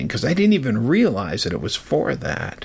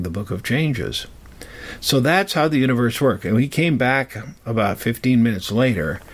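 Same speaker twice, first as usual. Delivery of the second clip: normal talking voice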